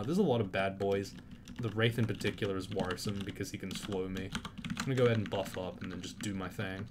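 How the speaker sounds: ordinary talk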